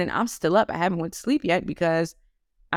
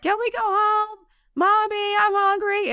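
put-on much higher child voice, whiny; kids whining; slower than usual. Kids in the back seat pleading with mom, high whiny child voice. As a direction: play the hungry kids pleading.

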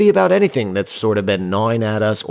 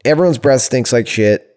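normal talking voice

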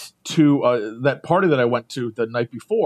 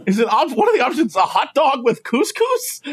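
laughing disbelief; 7 semitones higher than usual. Retold or imitated. Baffled question to the attendant, laughing.

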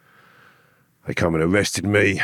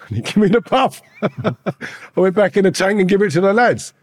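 laughing through the words, high and loud; can't keep the laugh in while telling it; ex-prisoner recalling the seized puff, laughing loudly; 6 dB louder than usual. Real, not acted. Laughing at his own story.

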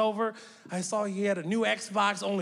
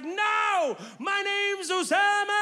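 put-on kid shouting; 9 semitones higher, much slower than usual. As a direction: yell it like a nine-year-old who's had enough. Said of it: "He was like".

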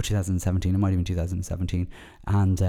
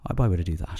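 usual speaking voice

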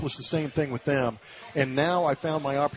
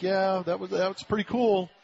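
understated reply; 5 semitones higher than usual. Retold or imitated. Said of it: "She's kinda quiet".

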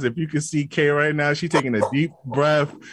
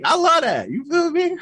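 excited shout; 12 semitones higher than usual. Real, not acted. Hyping up the podcast hosts, excited high shout. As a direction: shout it with glee. Hyped reaction.

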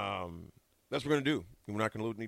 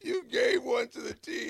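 laughing; 14 semitones higher, 5 dB louder than usual. Real, not acted. Repeats the joke, laughing.

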